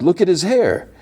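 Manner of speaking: the doctor, alarmed at the hair, in a high startled cry; startled exclaim. Retold or imitated. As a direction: point it out in shock. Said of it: "And the doctor's like".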